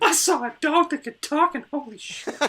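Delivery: high silly tripping voice. Pretend. Co-host playing a tripping guy in a high silly voice. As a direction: squeak it out like a stunned tripper.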